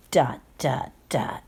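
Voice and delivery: low, fading suspense; ominous dot dot dot. Acted. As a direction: drop down into suspense. Suspense pause read aloud.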